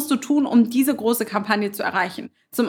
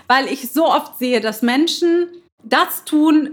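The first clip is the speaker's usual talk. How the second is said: ordinary talk, nothing marked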